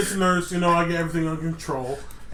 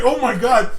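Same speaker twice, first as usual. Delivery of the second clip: mock panicked outburst, louder, through laughter; 5 semitones higher, 7 dB louder than usual. Classmate alarmed that her friend can't breathe, mock panic. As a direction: imitate a panicking classmate, amused. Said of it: "She started panicking".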